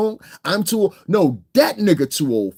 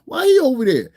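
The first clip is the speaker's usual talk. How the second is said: retold surprise; 10 semitones higher than usual. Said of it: "I said".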